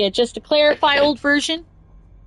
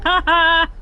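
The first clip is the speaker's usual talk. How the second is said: taunting rover; 4 semitones higher than usual. Retold or imitated. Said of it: "its side going".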